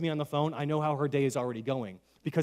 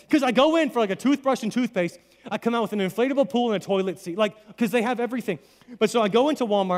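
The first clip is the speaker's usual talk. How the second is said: excited; 6 semitones higher, 5 dB louder than usual. Real, not acted. Excited Walmart comedy bit.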